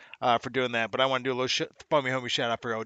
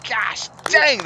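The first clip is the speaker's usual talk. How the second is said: higher, louder burst of admiration; excited exclamation; 10 semitones higher, 7 dB louder than usual. Real, not acted. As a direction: blurt it out, thrilled. Excited reaction to the plane.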